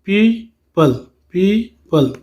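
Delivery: "people" split as pee, pause, pul; syllable breakdown. Shown as a demonstration. Teacher counting syllables for pupils, "people" broken into pee and pul. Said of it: "टू syllable है".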